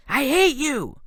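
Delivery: high, loud cry of I hate you; hateful scream. A narrator's reading. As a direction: spit it out at the one who failed his sister. Nico screams at Percy.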